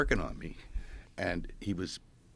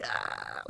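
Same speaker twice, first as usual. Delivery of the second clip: low kung fu grunt, imitating the healer; 8 semitones lower, 4 dB quieter than usual. Retelling the healer's energy push, low mock kung fu grunt. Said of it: "like kung fu".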